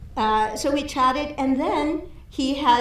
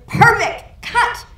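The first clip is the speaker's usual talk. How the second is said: much higher, louder call of a satisfied director; pleased director; 11 semitones higher, 7 dB louder, much slower than usual. Retold or imitated. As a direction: shout it across the set, delighted. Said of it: "And he says".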